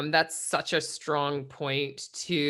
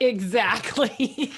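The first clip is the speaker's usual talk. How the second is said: drawn-out mock agreement; 4 semitones higher, 4 dB louder, much slower than usual. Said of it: "You like how it was like".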